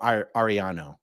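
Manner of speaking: Ariano with plain American r; Americanized Latin name, Ariano as "ar-ee-AH-no"; American accent. Shown as a demonstration. Streamer trying a Latin name, American accent. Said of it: "just with an American accent".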